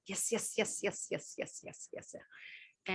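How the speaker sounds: eager yeses fading down to a whisper; willing yes, dying away to a whisper. Retold or imitated. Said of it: "have that attitude, like".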